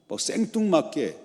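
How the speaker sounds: normal talking voice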